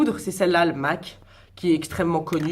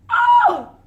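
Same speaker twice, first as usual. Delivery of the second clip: sudden high yelp of dismay; startled cry; 8 dB louder than usual. Real, not acted. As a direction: shriek at the mistake. Makeup gone wrong, sudden cry.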